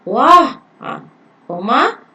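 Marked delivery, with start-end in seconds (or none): 0.0-0.6 loud, high cry of amazement; exclamation demo. Shown as a demonstration. Teacher showing an exclamation sentence, loud amazed wah. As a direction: sell the wonder for the class. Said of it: "belakangku ada tanda seruan".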